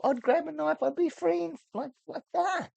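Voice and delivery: high, frantic rant; exasperated. Real, not acted. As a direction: squeal in frustration at stupid characters. Mocking film characters' choices.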